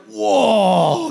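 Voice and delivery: loud, high mock gasp of amazement; hammed-up stunned whoa; amazed whoa. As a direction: play the kid seeing the huge pile. Visiting kids amazed at the Lego pile.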